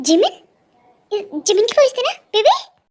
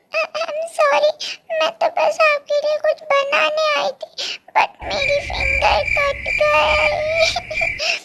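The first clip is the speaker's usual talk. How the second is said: higher, small apologetic voice; higher, teary Jimin apologising; tearful girlfriend; 8 semitones higher, slower than usual. Acted. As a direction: say sorry like a hurt girl. Jimin voiced, higher, hurt finger.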